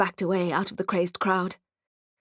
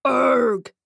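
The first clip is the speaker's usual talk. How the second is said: a hint of an orc grunt, a bit louder; grunted greeting; 3 semitones higher, 5 dB louder than usual. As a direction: grunt it out as hello. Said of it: "he grunted in greeting".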